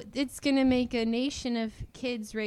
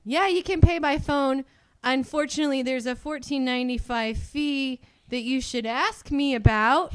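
louder, higher, leading customer-service voice; heavy hinting; 4 semitones higher, 6 dB louder than usual. Retold or imitated. Bank rep hinting that a customer can avoid the fee, pointed. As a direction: nudge the listener pointedly toward asking. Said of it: "I would try and hint to them".

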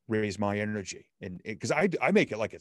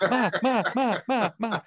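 put-on high, fast kid calling for mum with a laugh; childish whining; 12 semitones higher, 5 dB louder than usual. Wife mocking husband as a toddler calling mum, high and laughing. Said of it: "You've created a five-year-old".

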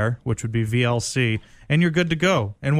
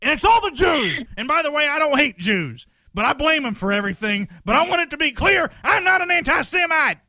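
mock Alex Jones rant; 14 semitones higher than usual. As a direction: rant like a paranoid radio host screaming into the mic. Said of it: "He's like".

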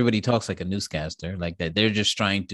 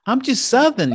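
put-on Southern accent; 7 dB louder than usual. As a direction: chirp it in a Southern belle voice. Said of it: "the accent does not work".

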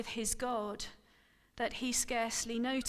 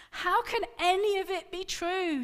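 high, louder anguished cry; 8 semitones higher, 6 dB louder than usual. Acted. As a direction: cry out in despair at old age. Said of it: "she cried at last".

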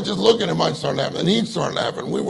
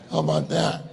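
ordinary talk, nothing marked

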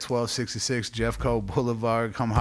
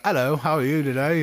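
mock English accent, a bit louder; put-on English accent; 5 semitones higher, 5 dB louder than usual. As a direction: greet the customer in a hammed-up English accent. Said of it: "When do I go back to regular voice?".

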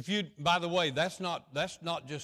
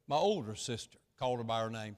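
ordinary talk

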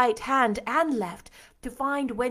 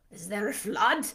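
cried out. A narrator's reading. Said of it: "he cried".

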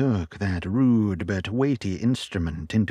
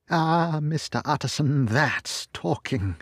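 a bright higher burst that settles down; eager cry; 8 semitones higher than usual. A narrator's reading. Butler approving the lawyer's plan with an eager cry. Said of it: "cried the butler".

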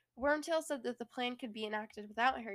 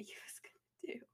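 hushed whisper; 12 dB quieter than usual. Real, not acted. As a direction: whisper it like a secret. Whispered aside.

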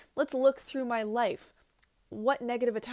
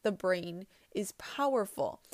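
usual speaking voice

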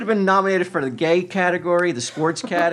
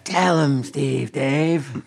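wolf voice; 2 semitones lower, much slower than usual. Pretend. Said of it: "That's my wolf voice".

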